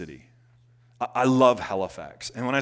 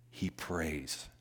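hushed; 6 semitones lower, 11 dB quieter than usual. Acted. Repeated refrain, dropped low.

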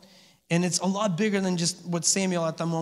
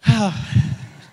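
loud, heaved sigh; 6 dB louder than usual. Real, not acted. Preacher pausing after joking about complaining spouses, loud sigh. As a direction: let out a big sigh. Reacting after his joke.

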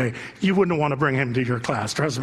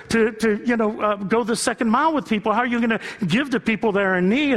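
impassioned preaching; 6 semitones higher than usual. Acted. Pastor challenging the congregation, building preaching. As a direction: press each question harder on the flock.

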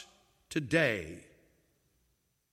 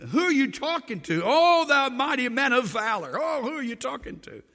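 lightly voiced puzzled Gideon, higher; a hint of a bewildered man looking around; mild surprised voice; startled Gideon; 6 semitones higher, 5 dB louder than usual.

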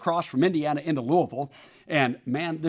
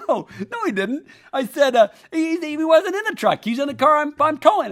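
higher, louder protest; insistent denial; 9 semitones higher, 5 dB louder than usual. Retold or imitated. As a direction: insist the cat can't be gone. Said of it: "And I said".

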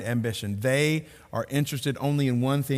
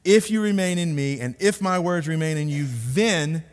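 "if" and "then" hit hard, slightly raised voice; stress on THEN; 3 semitones higher than usual. Shown as a demonstration. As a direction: punch "if", then land firmly on "then". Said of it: "put a big "then" in parentheses".

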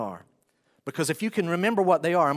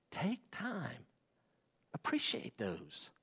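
soft tender urging; 11 dB quieter, slower than usual. Real, not acted.

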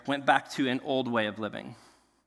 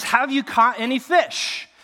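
raised, slow call across water; mock yell; 9 semitones higher, 6 dB louder than usual. Jesus calls to the distant boat, yelling. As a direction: yell it out slowly, higher and louder. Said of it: "he yells at these people".

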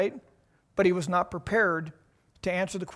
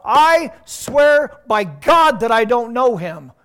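very loud, slow, forceful oath; swearing in court; 4 semitones higher, 13 dB louder, much slower than usual. Acted. Peter swearing before the court, loud and slow. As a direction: bellow the oath as if under law. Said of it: "Can you see the intensity here?".

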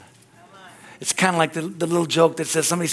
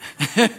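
short breathy chuckle; 4 semitones higher than usual. Real, not acted. Preacher amused at the one-sided battle, chuckle. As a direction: laugh to yourself. Laughs at own point.

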